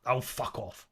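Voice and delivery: low, flat brush-off to her own inner critic; mild mock dismissal; dismissive. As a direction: wave the thought away curtly. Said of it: "which is just going".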